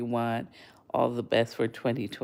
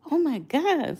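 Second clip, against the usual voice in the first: high shocked gasp of disbelief; 12 semitones higher than usual. Retold or imitated. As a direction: react like you can't believe your eyes. Shocked at food stacking.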